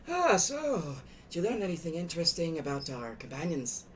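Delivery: curious chieftain asking; chief's voice. Acted.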